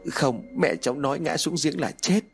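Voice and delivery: high voice, mildly scared; frightened child. A narrator's reading. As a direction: protest like a scared little child. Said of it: "Trinh sợ hãi". (giọng trẻ sợ hãi, cao)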